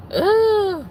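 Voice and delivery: high, loud groan of frustration; exasperated groan. Retold or imitated. Worker retelling being sent home early, exasperated groan. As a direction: let out a squealed ugh.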